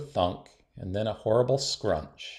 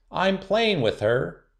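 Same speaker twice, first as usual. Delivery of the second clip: higher, louder and slow; trumpeted; 8 semitones higher, 4 dB louder, slower than usual. A narrator's reading. Girl standing up to a doll snatcher, trumpeting. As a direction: blast it out like a trumpet. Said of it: "Willow trumpeted".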